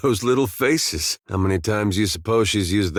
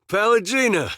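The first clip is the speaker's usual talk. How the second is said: high-pitched call of recognition; 12 semitones higher than usual. Acted. Spotting someone he knows by the feathers, calling out. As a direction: call the name out to a friend.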